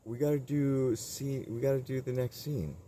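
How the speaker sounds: high, loud mock crew guy voice; mock film crew guy. Crew guy calls Bruce to set, mock high and loud. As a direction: call out to Bruce like a nagging assistant. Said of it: "It's like".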